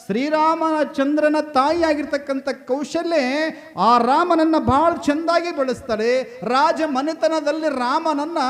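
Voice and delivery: high, loud, declaimed discourse; full-force preaching. Acted. Preacher extolling mothers to the congregation, full-force preaching.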